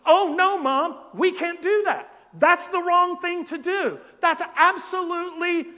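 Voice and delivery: high, loud indignant son protesting to his mother; a son refusing the scheme, loud and high. Retold or imitated. Jacob loudly refusing his mother's deceitful plan, high indignant voice. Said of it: "That's what he's gonna say".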